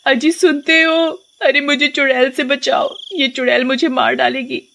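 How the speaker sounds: high, wailing wife calling husband; panicked cry for help. Acted. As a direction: cry out to be rescued. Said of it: "क्यों चिल्ला रही हो".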